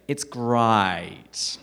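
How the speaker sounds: exaggerated Australian vowel, "great" stretched towards "grite"; broad, loud mock-Australian drawl on "great"; Australian accent. Shown as a demonstration. Showing Americans how Australians say "great", in a hammed-up Australian accent. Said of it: "An Australian would say".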